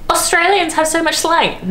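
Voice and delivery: high, put-on mocking voice of a Brit. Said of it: "They're always like".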